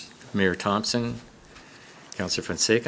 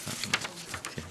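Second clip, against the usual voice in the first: whispered aside to self, barely voiced; whispering; 2 semitones lower, 17 dB quieter than usual. Real, not acted. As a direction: mutter it under the breath to yourself. Chair muttering aside off-mic.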